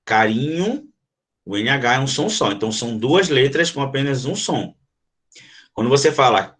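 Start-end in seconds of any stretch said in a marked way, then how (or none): none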